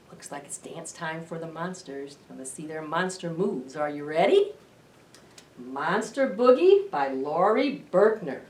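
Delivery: plain reading voice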